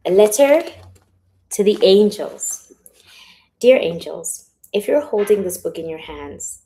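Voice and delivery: projected, heartfelt reading that settles after the opening; reading a letter aloud. Acted. Author reading letter to her angels, projected and sincere. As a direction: announce the title loudly, then read it out.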